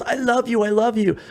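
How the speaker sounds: high, gushing mock declaration; imitated adoring fan. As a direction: mimic a fan overcome with joy. Retelling overjoyed fan's thanks.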